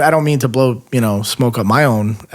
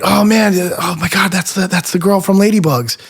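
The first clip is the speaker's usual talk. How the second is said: mock starstruck gush; 7 semitones higher than usual. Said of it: "it's like".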